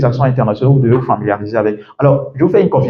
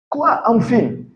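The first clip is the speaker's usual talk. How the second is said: much higher, puzzled double question; baffled native listener; 9 semitones higher, 4 dB quieter, much slower than usual. Acted. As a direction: squeak it out as someone who misheard. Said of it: "Le gars, il est là, il réfléchit".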